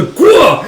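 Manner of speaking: high, loud "gwa" yell; manga-style battle grunt shouted high; fighting cry. Acted. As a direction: let out a punching cry.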